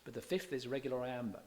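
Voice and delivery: usual speaking voice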